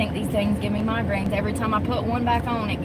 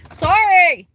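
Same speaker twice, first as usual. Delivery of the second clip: loud sorry; 8 semitones higher, 8 dB louder than usual. Real, not acted. Loud apology after muttering.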